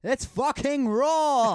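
high, loud, drawn-out chef yell; Gordon Ramsay. Acted. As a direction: roar it in disgust, stretching the words. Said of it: "best Gordon Ramsay impression".

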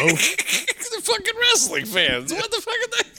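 high-pitched, laughing shout; laughing outburst. Real, not acted. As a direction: crack up while yelling incredulously. Cracking up mocking wrestling fans.

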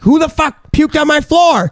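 high-pitched outraged yell; retold freak-out. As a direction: demand an answer in replayed drunken fury. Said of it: "I'm getting mad and I'm freaking out".

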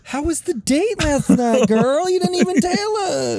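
put-on high, chatty girl-talk voice; gossipy girlfriend voice. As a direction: squeal it like a nosy best friend. Gossiping friend asking about date.

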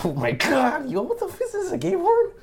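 very high shocked squeal; shocked shriek. Real, not acted. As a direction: squeal in disbelief at the screen. Shocked reaction to a video.

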